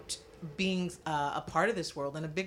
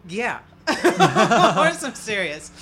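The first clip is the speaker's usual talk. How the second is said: laughing insistence; 6 semitones higher, 13 dB louder, slower than usual. Retold or imitated. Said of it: "I said".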